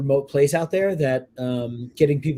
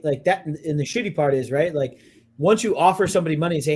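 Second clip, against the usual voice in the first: usual speaking voice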